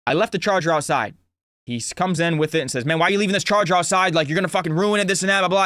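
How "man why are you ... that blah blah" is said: quick, higher-pitched mock scolding; upset dad. Dad comes in with the charger left outside, upset. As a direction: rattle it off like an irritated dad. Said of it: "he's getting upset".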